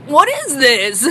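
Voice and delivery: put-on baffled boyfriend. Boyfriend sees the mashed cake.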